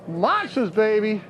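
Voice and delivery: ordinary talk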